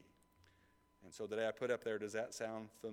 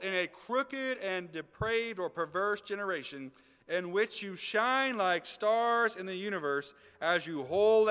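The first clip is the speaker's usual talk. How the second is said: preaching scripture reading; 8 semitones higher, 9 dB louder than usual. Acted. Pastor reading Paul's letter to the congregation, preaching at full force. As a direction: proclaim Paul's words to the church.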